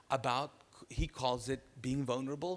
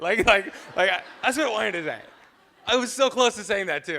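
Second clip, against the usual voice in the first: loud laughing through the words; 7 semitones higher, 13 dB louder than usual. Real, not acted. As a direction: laugh at yourself as you admit it. Laughs at own confession.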